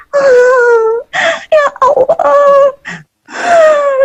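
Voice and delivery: "ya Allah" ditirukan merintih tinggi; tiruan tangis. Retold or imitated. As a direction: meniru jamaah menangis dengan nada tinggi. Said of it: "sering diajak nangis". (tiruan menangis saat muhasabah)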